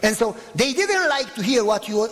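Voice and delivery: ordinary talk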